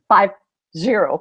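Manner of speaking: normal talking voice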